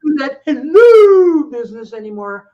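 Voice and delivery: put-on a touch of a fed-up girlfriend; exasperated girlfriend. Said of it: "And like".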